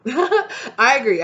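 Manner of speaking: amused laughing agreement. Real, not acted. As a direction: agree through a real laugh.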